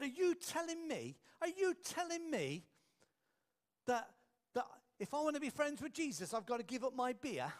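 high, indignant outburst; angry. Retold or imitated. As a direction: retell a furious man's protest, pitch way up. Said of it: "this man stormed over to him".

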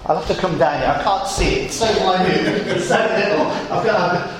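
lightly voiced, ironic peering at something tiny; a hint of God straining to see a speck; mock squinting God. Preacher voicing God mocking the tiny tower, lightly ironic. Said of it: "Don't miss the irony".

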